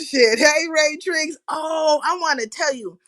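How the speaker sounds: ordinary talk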